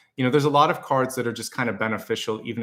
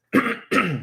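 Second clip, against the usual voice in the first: sharp throat clearing; clears throat; 12 semitones higher, 3 dB louder than usual. Real, not acted.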